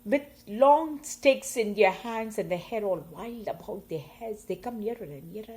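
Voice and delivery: usual speaking voice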